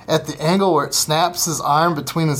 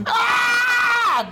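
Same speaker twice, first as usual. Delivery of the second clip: put-on hugely higher yelled scream of a hurt kid; imitated scream of pain. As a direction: scream like the little brother who fell off the roof.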